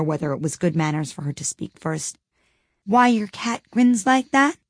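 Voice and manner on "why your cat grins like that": timid. A narrator's reading. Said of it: "said Alice a little timidly".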